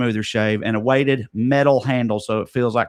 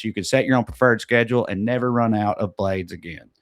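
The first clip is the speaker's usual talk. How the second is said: ordinary talk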